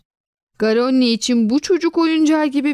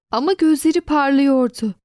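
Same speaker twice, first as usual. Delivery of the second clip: usual speaking voice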